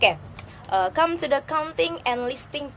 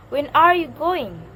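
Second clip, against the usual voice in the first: "are" raised high and loud, then falling through "going"; question-word intonation; 4 semitones higher, 8 dB louder than usual. Shown as a demonstration. Teacher modelling a question with question words, rise then fall on "going". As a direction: rise in the middle, drop on "going". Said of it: "horizontal line and then rising".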